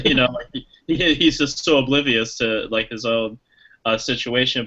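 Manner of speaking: ordinary talk, nothing marked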